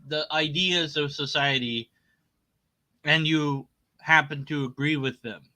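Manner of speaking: ordinary delivery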